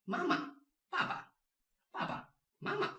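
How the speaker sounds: "Mama" and "Baba" with a short light ending; neutral second syllable. Shown as a demonstration. Teacher reviews mom and dad, slight neutral-tone endings. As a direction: stress the first syllable, drop the second lightly. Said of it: "just do it slightly".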